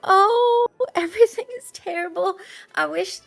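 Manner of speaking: high, fake damsel-in-distress voice; put-on helpless voice. Adriel fakes helplessness for the guard in a high voice. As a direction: play the helpless victim, overdone. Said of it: "what voice is that? ... putting on a bit of a performance".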